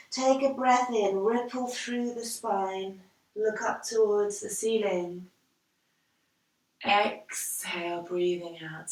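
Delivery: soft, slowing yoga cue; hushed, drawn-out exercise guidance; guided breathing. Real, not acted. Guides cat and cow breathing.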